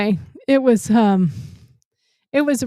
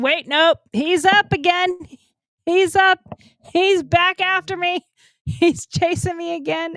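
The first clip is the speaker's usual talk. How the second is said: higher, urgent re-enacted report; retold alarm; 8 semitones higher than usual. Driver telling dispatcher the attacker is up, retold alarm. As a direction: relive the alarm in a raised pitch.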